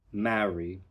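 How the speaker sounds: normal talking voice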